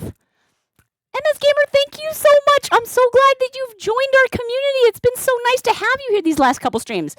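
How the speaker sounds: very high-pitched, effusive thanks; gushing welcome. Real, not acted. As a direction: greet the new member way up high. Gushing welcome to new member.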